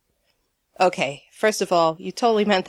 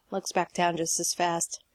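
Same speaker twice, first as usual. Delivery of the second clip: ordinary delivery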